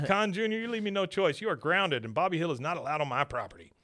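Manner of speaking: retold telling-off, starting high and forceful; mock parental yelling. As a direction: lay down the law as an angry dad. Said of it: "she got yelled at pretty good".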